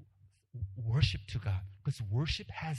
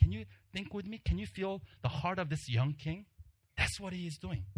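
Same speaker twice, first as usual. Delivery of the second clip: ordinary delivery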